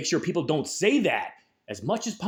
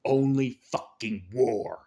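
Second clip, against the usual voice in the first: low grim repeat; 5 semitones lower, slower than usual. Real, not acted. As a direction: lower and slow, pulled back after the yell. Echo after the shout.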